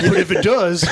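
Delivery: high laughing wheedle; laughing hopeful. Retold or imitated. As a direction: beg hopefully while laughing. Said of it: "He's like".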